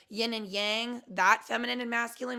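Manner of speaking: usual speaking voice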